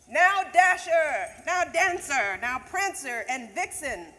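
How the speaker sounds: loud, high calling out names; calling the reindeer. Acted. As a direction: call out the reindeer like Santa urging them on. Santa calling his reindeer.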